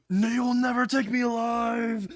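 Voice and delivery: high, drawn-out mock scream. A narrator's reading. Runaway Izuku yelling back as he flees, screaming. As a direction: yell it high and stretched out in defiance. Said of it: "fucking screaming".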